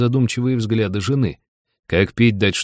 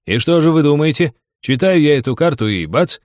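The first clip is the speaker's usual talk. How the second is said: raised, louder storytelling; animated anecdote build-up; 6 semitones higher, 4 dB louder than usual. Acted. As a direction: build suspense for your mates, then pop the surprise. Серёга builds to the punchline. (азартно рассказывать байку)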